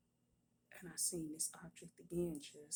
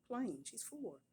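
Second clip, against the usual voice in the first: ordinary talk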